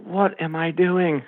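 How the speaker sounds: high, slow self-doubt; overwhelmed, asking yourself slowly; incredulous. Retold or imitated. Retold first-day feeling of being outclassed.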